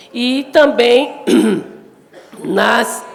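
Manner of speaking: congested voice, throat clearing; sick with a cold. Real, not acted. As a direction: speak through a head cold. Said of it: "peço desculpa pela minha voz, estou um pouco gripada".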